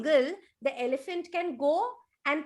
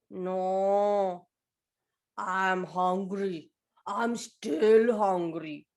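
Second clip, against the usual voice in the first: lower, slow whiny complaint; lightly voiced sulky elephant; 7 semitones lower, much slower than usual. Elephant answering the seller, slow low complaint. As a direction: drag out the hunger.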